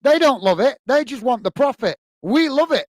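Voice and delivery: real indignant outburst; heated rant. Real, not acted. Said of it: "I'm not ranting at you".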